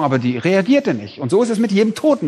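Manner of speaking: ordinary talk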